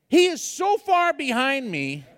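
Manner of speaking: ordinary talk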